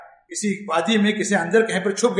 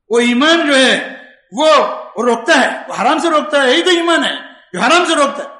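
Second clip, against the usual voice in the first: ordinary talk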